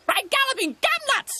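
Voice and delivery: high, loud squawked exclamation; astonished cartoon magpie yell; magpie shouting. A narrator's reading. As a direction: shout it in a piercing bird voice. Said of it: "shouted Sticky Beak".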